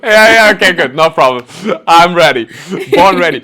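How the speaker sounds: loud, high, cocky boast; playful bravado. Real, not acted. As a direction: pump yourself up with a grin, mock cocky. Playful bravado after Dutch tease.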